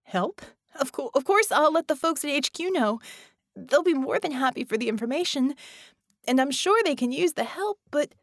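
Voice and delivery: Ramona's voice. A narrator's reading. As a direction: voice Ramona, flustered. Ramona speaks as character.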